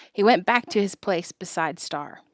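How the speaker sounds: usual speaking voice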